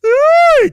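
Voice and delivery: very high, loud "ooh"; piercing whoop at the play; excited whoop. Real, not acted. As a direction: let out a long high whoop. Reacting to a good play.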